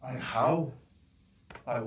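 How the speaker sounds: usual speaking voice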